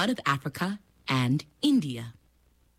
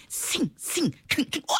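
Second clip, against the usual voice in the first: sound effects with a yell; 9 semitones higher than usual. Acted. Things dropped, sound effects.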